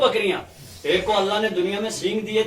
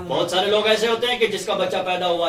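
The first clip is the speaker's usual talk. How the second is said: normal talking voice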